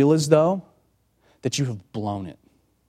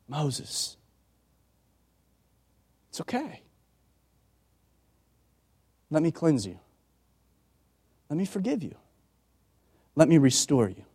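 much softer, gentle reassurance; tender Jesus; 3 semitones higher, 4 dB quieter than usual. Acted. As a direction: speak like forgiving someone who is ashamed. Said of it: "Jesus comes and he says".

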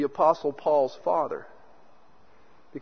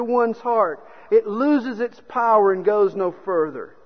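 raised, forceful sermon delivery; impassioned preaching; 5 semitones higher, 6 dB louder than usual. Real, not acted. Preacher telling congregation love absorbs evil, impassioned. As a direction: preach it out with higher, emphatic pitch.

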